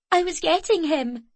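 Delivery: pleading. A narrator's reading. Girl defending.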